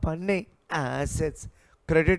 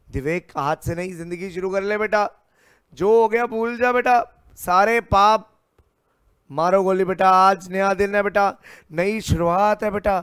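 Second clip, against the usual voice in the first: rousing pep talk; 3 semitones higher, 6 dB louder than usual. Acted. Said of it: "अब सुन ले दिवेक".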